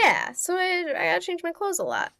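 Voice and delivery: mocking the killer. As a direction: whine the lame excuse. S0 continues mocking his excuse.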